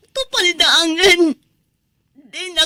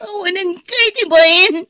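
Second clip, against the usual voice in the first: crying, louder accusations; tearful furious reproach; sobbing anger; 3 semitones higher, 5 dB louder than usual. Acted. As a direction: scold him through sobs. Betrayed wife sobbing accusations.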